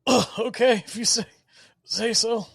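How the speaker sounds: high, loud, halting strain; strained high voice, stumbling over the words; injured, stumbling. Acted. Bleeding Rubo stumbles back from Damien and gives in, high and strained. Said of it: "He just kinda stumbles back from Damien".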